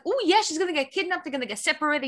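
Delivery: high-pitched retold thrill; eager excitement. Reader retelling her hopes for a kidnapping plot, excited. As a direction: gleefully replay a reader's reaction.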